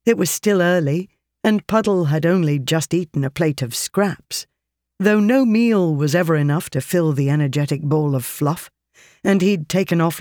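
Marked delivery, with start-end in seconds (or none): none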